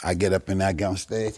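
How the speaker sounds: ordinary talk, nothing marked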